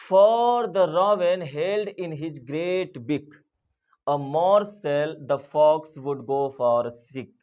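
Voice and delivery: slow, deliberate classroom poem reading; poem recitation. Acted. Teacher reading an English poem slowly to her class. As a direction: read the verse slowly for students.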